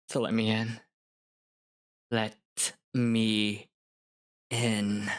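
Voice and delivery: sinister demand. Acted. Nightmare Judith demanding Oliver let her in, low and eerie. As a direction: let each word creep in. Sinister whisper-like ending line.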